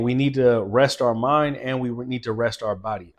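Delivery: ordinary delivery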